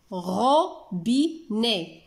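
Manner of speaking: "Robinet" in three slow, separate beats; syllable by syllable. Shown as a demonstration. Teacher recapping three syllables for pupils, syllable by syllable.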